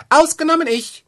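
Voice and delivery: the wren shrieks from the eagle's feathers, high and loud; tiny bird shriek. A narrator's reading. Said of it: "schrie der kleine Kerl".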